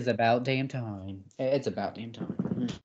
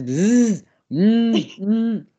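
buzzing imitation; 8 semitones higher, 7 dB louder, much slower than usual. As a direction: hum like a phone buzz. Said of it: "all I'm hearing is".

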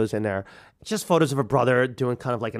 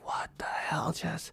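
hushed, whispery disbelief; appalled whisper; 3 semitones higher, 12 dB quieter, slower than usual. Retold or imitated. New husband judging the family to himself, appalled whisper. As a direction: whisper it as a shocked private thought. Retells his appalled private thoughts.

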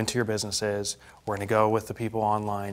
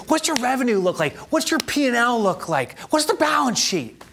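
a hint of a drilling coach, higher; imitating coach; 10 semitones higher than usual. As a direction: fire questions like a coach. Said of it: "He's like".

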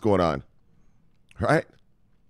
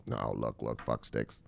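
much lower, much softer gruff voice; mock low growl; 12 semitones lower, 15 dB quieter, slower than usual. Vlogger teasing his viewers in a low mock growl. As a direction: drop into a low mock grumble.

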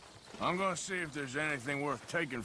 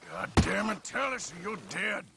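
raised, high, forceful threat; angry threat; 6 semitones higher, 4 dB louder than usual. Acted. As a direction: bear down on a captive, losing patience. Threatens captive poacher.

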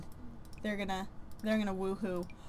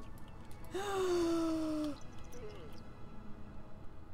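long gasp; 5 semitones higher, 7 dB quieter than usual. Real, not acted. Shocked by game event.

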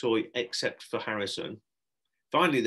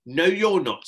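loud, higher forbidding tone; stern refusal; 7 semitones higher, 10 dB louder, slower than usual. Acted. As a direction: cut the child off firmly. Adult forbids the boy.

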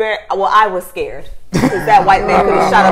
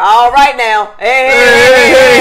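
loud, raised mock calling out; imitated background shouting; 3 semitones higher, 8 dB louder, slower than usual. Teasing the coach heard behind the video, loud imitated hollering. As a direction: imitate someone hollering to break it up. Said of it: "you hear his ass in the background".

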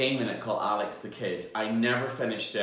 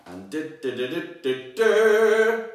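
drumroll fanfare; 5 semitones higher, 5 dB louder than usual. Acted. Fanfare sound before the reveal.

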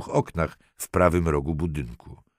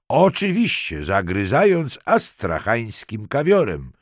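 higher, louder shouted-back reply; calling back; 6 semitones higher, 5 dB louder than usual. A narrator's reading. Detective answers Halinka through the bathroom door, calling back. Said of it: "odkrzyknął".

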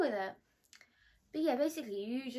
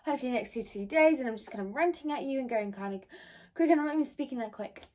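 ordinary delivery

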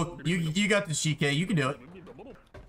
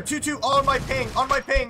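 alarm shout; 10 semitones higher, 5 dB louder than usual. Real, not acted. Callout of enemy in firefight.

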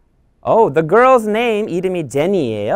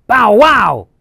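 barking dog; 4 semitones higher, 6 dB louder than usual. Acted. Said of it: "Brown says".

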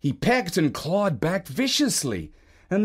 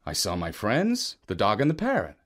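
ordinary delivery

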